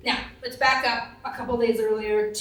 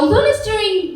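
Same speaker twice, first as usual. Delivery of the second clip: mom's voice; 10 semitones higher, 4 dB louder, slower than usual. Retold or imitated. Mother voiced reporting on dog.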